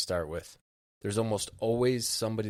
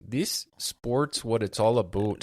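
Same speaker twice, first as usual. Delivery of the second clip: slow, louder put-on Canadian; mock Canadian accent; 2 semitones higher, 5 dB louder, slower than usual. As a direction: exaggerate the Canadian vowel in "about".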